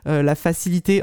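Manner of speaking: ordinary talk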